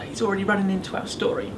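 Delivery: ordinary delivery, nothing marked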